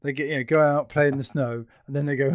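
normal talking voice

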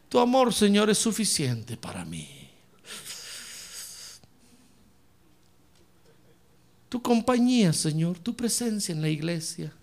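languid, dropping away low and soft; mock contented prayer. Lounging believer to God under a palm tree, mock blissful prayer. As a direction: sigh it out like someone lounging. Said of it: "con un coco bajo la palmera, diciendo".

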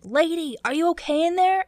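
high, loud imitation of a captain; retold captain shouting to someone shut inside; yelling captain. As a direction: call out loudly through a door, mock-urgent. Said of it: "he's, like, yelling".

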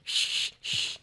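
unvoiced shushing hiss; hushing shh. Teller imitating vandals hushing each other at night. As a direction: hush them like vandals caught in the act.

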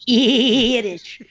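bleating, quavering goat voice; wobbly billy-goat bleat. Pretend. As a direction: draw out the first vowel like a bleat. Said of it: "I always think of a billy goat".

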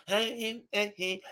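soft mock taunt; hushed sing-out of a kid's jeer. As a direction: imitate a taunting kid, quietly. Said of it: "this kid was taunting me".

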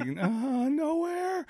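retold mock innocence; innocent kid. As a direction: play dumb in a high sing-song. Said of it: "And I was just like".

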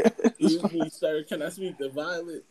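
high, polite phone voice; proper and careful, like a stranger; overly polite caller. Retold or imitated.

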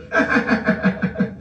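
high stuttering cackle; helpless laughing at them; mocking laughter. Real, not acted. Ridiculing the viewer.

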